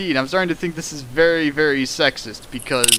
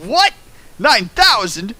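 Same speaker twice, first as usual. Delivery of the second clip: high, loud disbelieving yelp; mock shock; 7 semitones higher, 4 dB louder, slower than usual. Reader reacting to the meme in hammed-up surprise. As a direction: feign astonishment.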